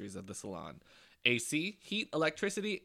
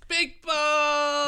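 excited shout; 10 dB louder than usual. Acted. Sponsor name shouted as jingle.

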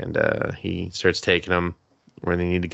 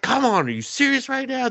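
very high-pitched retold exasperation; mock exasperation. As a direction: protest in disbelief, pitch shooting up. Exasperated leader scolds in retold scene.

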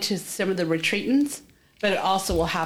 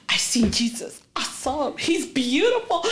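mock sobbing voice, climbing higher; imitated blubbering; 6 semitones higher than usual. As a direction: imitate blubbering, stretching the last words. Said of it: "she's blubbering and blubbering and blubbering".

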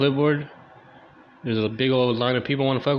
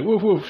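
high, loud playful woof; mock dog bark; 9 semitones higher, 5 dB louder than usual. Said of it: "barking, like".

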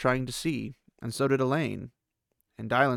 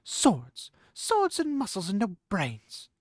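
Nynaeve grumbling; 8 semitones higher, slower than usual. A narrator's reading.

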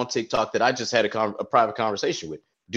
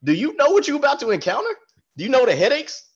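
mock warning; 8 semitones higher than usual. Warning someone mockingly.

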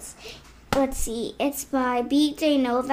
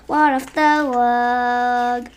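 drawn-out silly voice; 4 dB louder, much slower than usual. Acted. Silly song line, drawn out.

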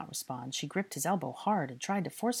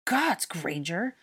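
loud, raised, indignant scolding; angry outburst; 5 semitones higher, 6 dB louder than usual. A narrator's reading. Draco recovering from choking, angrily scolding Hermione. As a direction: tear into her accusingly.

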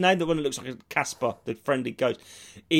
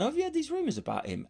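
gleeful gossip; 9 semitones higher, 8 dB quieter, faster than usual. Retold or imitated. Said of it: "took great delight and said".

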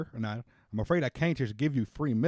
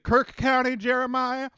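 high, louder call to Jeremiah; calling across the room; 11 semitones higher, 4 dB louder, slower than usual. Acted. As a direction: call out to someone across the shop. Clerk calls across to Jeremiah.

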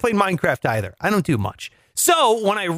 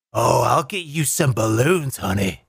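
low-pitched imitation father reply; low, put-on daddy voice; mock sinister dad; 8 semitones lower than usual. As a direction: drop low and answer the child. Acted father answering the child.

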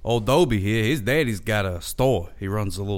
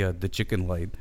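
usual speaking voice

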